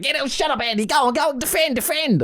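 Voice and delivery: high, hammed-up coach yelling; coach barking orders. Coach yelling at the injured player to defend. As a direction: bark orders at a hurt player. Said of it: "He went".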